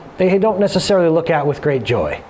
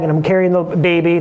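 ordinary talk

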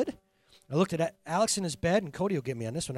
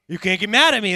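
joking defiance; 4 semitones higher, 10 dB louder than usual. Real, not acted. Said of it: "That's a technical term".

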